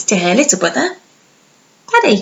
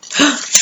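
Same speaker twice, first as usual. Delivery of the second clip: gasp; 7 semitones higher than usual. Acted. Dramatic reaction.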